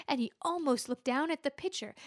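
ordinary delivery

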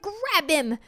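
high, urgent crowd cry; mob's cry. A narrator's reading. Townspeople spot the ghost slipping out, urgent cry. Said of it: "they cried".

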